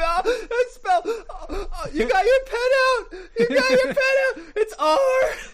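loud, very high hysterical spelling; screaming. Acted. Mother spelling the town for her attackers, screaming. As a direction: scream it while sobbing, half laughing. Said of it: "She's screaming".

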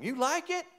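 squeaky-high, playful jump in pitch; showing-off husband. Acted. Husband to wife after opening pickles, showing off. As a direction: leap way up, fishing for praise.

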